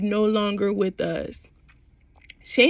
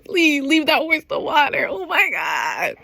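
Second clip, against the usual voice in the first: gushing; 4 semitones higher than usual. Real, not acted. Gushing over the scene.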